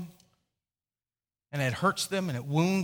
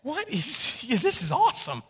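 amused; 6 semitones higher than usual. Retold or imitated. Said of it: "I'm entertained by this".

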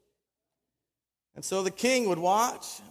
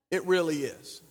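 ordinary delivery

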